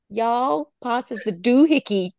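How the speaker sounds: self-conscious try at a Southern American accent. Shown as a demonstration. Co-host taking her turn, Southern American accent. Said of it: "say it in your best Southern American accent".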